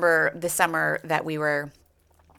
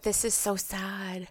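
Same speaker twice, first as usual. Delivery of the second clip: put-on softer, slow little-girl voice; imitating sad child; 3 semitones higher, 8 dB quieter, slower than usual. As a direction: say it like a teary kid. Voicing crying daughter.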